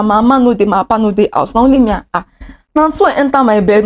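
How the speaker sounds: ordinary talk, nothing marked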